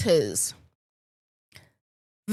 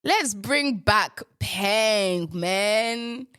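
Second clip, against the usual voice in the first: normal talking voice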